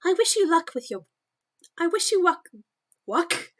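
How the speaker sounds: high, loud posh British lady; posh British accent. Acted. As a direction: trip over it in a lofty posh British voice. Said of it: "the fucking posh British accent".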